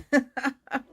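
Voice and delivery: real tickled laughing fit; self-amused laugh. Real, not acted. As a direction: laugh at yourself. Laughs at own doubt of cards.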